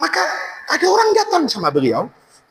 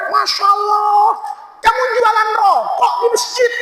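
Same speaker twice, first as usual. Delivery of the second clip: shocked shout; 9 semitones higher than usual. Retold or imitated. Imam shouts at the cigarette seller in the mosque, shocked. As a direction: yell it out in disbelief.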